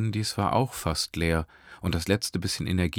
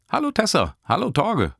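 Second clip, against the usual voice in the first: much higher, louder cheerful greeting; beaming Nesrin; 14 semitones higher, 5 dB louder than usual. A narrator's reading. (Nesrin grüßt strahlend, viel höher)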